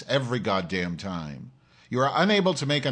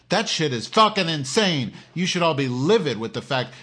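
angry rant; 5 semitones higher, 4 dB louder than usual. Real, not acted. Ranting about Hillary Clinton.

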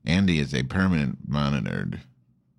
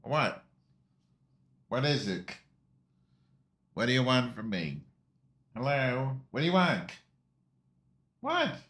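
higher, coaxing pet voice; talking to the dog; 6 semitones higher, 5 dB quieter than usual. Real, not acted. Host asking barking dog what it wants, high pet voice.

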